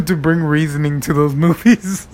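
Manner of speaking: can't hold back the giggle; words broken by laughter; laughing through words. Real, not acted. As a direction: laugh while getting the words out. Laughing at the remake idea.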